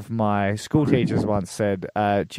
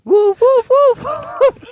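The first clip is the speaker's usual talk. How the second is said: very high, loud dog barks; squeaky little dog barking; high yappy woofs; 10 dB louder, much slower than usual. Shown as a demonstration. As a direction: yap like a tiny dog, way up high. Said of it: "let's try the higher again".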